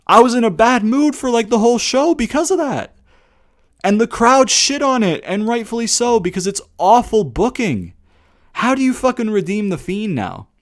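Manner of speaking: high-pitched, aggrieved venting; real indignant complaint, pitched high; exasperated rant. Real, not acted. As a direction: let frustration push the voice way up. Venting anger at the show opener.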